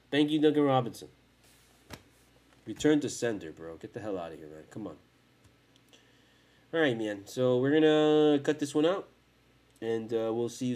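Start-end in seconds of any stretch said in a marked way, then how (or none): none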